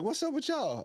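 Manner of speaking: high-pitched mock fan greeting; excited fans. Retold fans approaching the tent.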